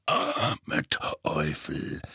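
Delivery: very slow, low gruff growl; growled pity. Acted. Moody about the Longbottoms, slow low growl. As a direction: drag it out low and grim. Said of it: "knurrte Moody".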